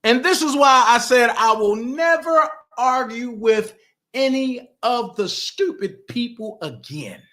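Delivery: usual speaking voice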